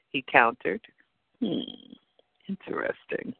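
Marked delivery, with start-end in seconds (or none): 1.4-3.3 musing to oneself. A narrator's reading. Woman weighing the man's answer, quietly musing. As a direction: think aloud softly. Narrator muses on his answer.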